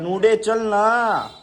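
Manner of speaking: slow, high drawn-out plea; pleading with dog. Real, not acted. Owner begs his stubborn dog Noodle to come along, slow and high pleading. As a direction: stretch it out, begging her to come.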